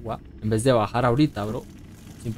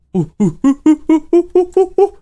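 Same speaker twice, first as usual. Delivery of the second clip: panicked whimpering rising high; 8 dB louder than usual. Real, not acted.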